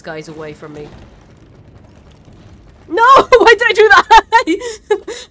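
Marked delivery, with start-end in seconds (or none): 2.9-5.3 high, loud wail breaking into laughter; drawn-out, high laughing scream; laughing shriek. Real, not acted. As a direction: cry out at your own blunder, laughing hard. Shrieks laughing at own mistake.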